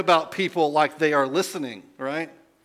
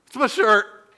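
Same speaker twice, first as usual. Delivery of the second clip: louder, firm "It's my shirt" after being told to speak up; 7 semitones higher, 6 dB louder than usual. Retold or imitated. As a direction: push "my shirt" out clearly. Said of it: "Speak up".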